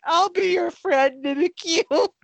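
high, slow, hammed-up tearful plea; mock sobbing. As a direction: pretend to sob with affection. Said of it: "I'm just, like, sobbing".

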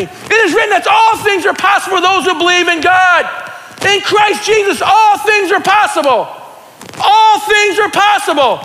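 high, ringing declaration with stretched words; excited preaching yell. Real, not acted. Preacher declaring God's promises to the church, excited preaching yell. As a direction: yell it out with full conviction. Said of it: "I'm just yelling 'cause I'm getting excited".

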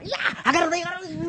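high, loud mock scramble; frantic shouting. Church brothers pile onto the possessed person, frantic shouting. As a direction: yell as if wrestling someone down.

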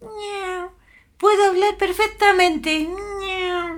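cat voice. Acted. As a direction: talk like a house cat, meows included. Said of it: "Dijo con voz de gato".

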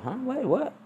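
put-on high startled kid; son's voice. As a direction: squeak it like a surprised child.

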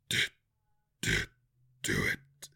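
low, garbled monster voice; garbled demand. A narrator's reading. Pinned monster begs Drizzt to finish it, garbled demand. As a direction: force out each word haltingly, deep and garbled. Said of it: "came a garbled demand".

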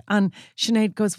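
normal talking voice

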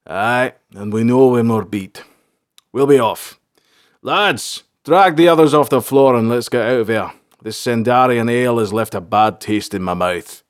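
lightly voiced sergeant rallying his men; concede, then order the lads out; beaten but blunt sergeant. A narrator's reading. Beaten sergeant leads men away.

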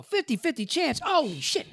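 high-pitched incredulous echo; shocked disbelief. Retold or imitated. Patient hearing fifty-fifty odds from doctor, shocked. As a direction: repeat the odds way up high, aghast.

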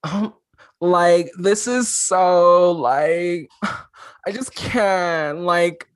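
put-on high, slow Valley Girl whine with sighs; Valley Girl vocal fry. Shown as a demonstration. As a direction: sigh through it like a bored valley girl.

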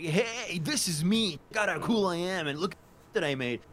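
high-pitched, show-off influencer parody; mock Instagram self-promoter. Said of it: "being on Instagram and being like".

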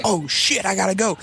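high-pitched retold alarm; hammed-up panicked realization; mock panic. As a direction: imitate a kid suddenly in trouble. Kid panicking as streetlight comes on.

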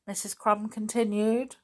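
ordinary delivery

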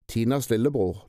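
ordinary delivery

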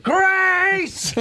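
high yelled name; Rooney shout. Retold or imitated. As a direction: shout her name from the back. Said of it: "Rooney being like".

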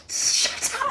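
voiceless, irritated hush; hissed whisper. Acted. Trying to listen over roaring blood, hissed whisper. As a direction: whisper it in annoyance. Acts Ralph hushing his blood.

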